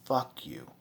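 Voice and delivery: quiet, low contempt; low, hushed telling-off. Pretend. To Mister Parsons, a low hushed fuck you. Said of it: "I would say".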